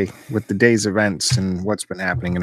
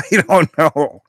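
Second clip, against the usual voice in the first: laughing; 5 semitones higher, slower than usual. Real, not acted. Laughing at the surfer voice.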